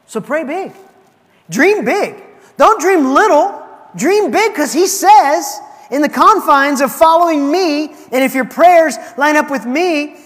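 rally the congregation upward; rousing preaching. Acted. Preacher builds to exhortation.

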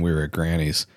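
ordinary delivery

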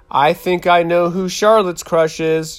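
high, lilting teasing tune; singsong it like a playground tease; a girl teasing a friend about her crush, sing-song; sing-songy. A narrator's reading. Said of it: "in a sing-songy way".

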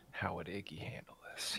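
whispered, very soft aside to oneself; hushed musing. Real, not acted. Player ponders his character's response to the hand, hushed musing. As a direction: wonder aloud under your breath. Player wonders aloud under breath.

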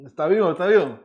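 loud, straining after choking on cricket. Real, not acted. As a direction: talk while still half choking. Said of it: "el grillo se me fue por mal camino".

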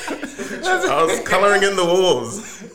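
put-on slow, laughing childish voice. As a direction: joke it through laughter. Joking childlike quip, laughing.

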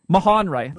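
"Mahonri" ending in "rye", falling tune; ending rhymes with eye. Shown as a demonstration. Host passes on Dave's correction, "Mahonri" ending in "rye". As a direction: state "Mahonri" firmly with final i as "eye". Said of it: "Dave's telling me that it's Mahonri".

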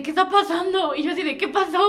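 high, loud baffled questions; alarmed confusion. Retold or imitated. Narrator retelling parents' odd behavior, asks what happened in alarm. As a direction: pitch up, confused and urgent.

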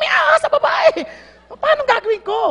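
high, loud, laughing cry of alarm; laughing mock alarm. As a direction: shout it through a laugh. Hunter torn, laughing.

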